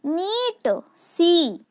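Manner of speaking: नीट and सी with drawn-out "ee"; slow phonics demo of नीट, सी; long E sound. Shown as a demonstration. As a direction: hold the vowel, "neeet, seee". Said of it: "वो भी long sound आएगा".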